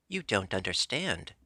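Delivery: usual speaking voice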